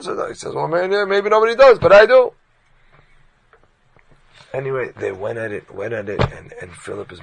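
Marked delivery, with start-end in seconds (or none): 0.5-2.3 loud, higher, building to I do; loud stubborn insistence; defiant retort. Retold or imitated. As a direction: stubbornly raise it. Chonan stubborn reply in argument.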